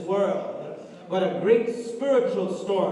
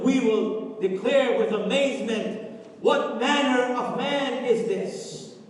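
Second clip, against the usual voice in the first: rising, louder preacher's cadence, half-sung; preaching at full force; 4 semitones higher, 4 dB louder than usual. Acted. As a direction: preach it out, building to a whoop. Preaching climax of sermon.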